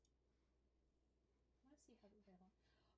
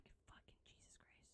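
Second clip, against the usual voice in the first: whispered frustration; 13 dB quieter than usual. Real, not acted. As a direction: hiss it under your breath. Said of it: "frustrated aside".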